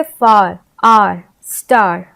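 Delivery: फार, आर, स्टार drawn out slowly with long आ; slow word demo. Shown as a demonstration. Teacher to students, slowly sounding out words. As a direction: stretch each आ clearly. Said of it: "आ के लिए भी use किया जाता है".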